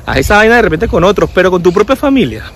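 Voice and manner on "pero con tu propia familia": ordinary talk, nothing marked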